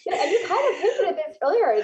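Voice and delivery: amused laugh carrying into words. Real, not acted. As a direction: speak while still laughing. Laughing after interruption.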